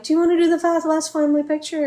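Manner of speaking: mock cheerful offer. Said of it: "And they're like".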